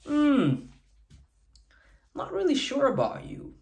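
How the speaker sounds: doubtful hum, then a soft breathy whisper; skeptical. Shown as a demonstration. Man whispering doubt to his date, skeptical. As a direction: whisper your doubt about her teasingly. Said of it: "you can be not sure about her".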